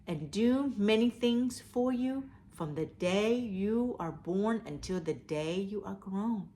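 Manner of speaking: ordinary delivery, nothing marked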